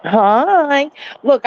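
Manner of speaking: normal talking voice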